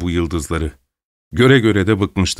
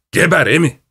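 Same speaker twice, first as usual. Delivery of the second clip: angry shout; 6 semitones higher than usual. Acted. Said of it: "yumruğunu sallayarak bağırdı". (yüksek sesle öfkeli beddua)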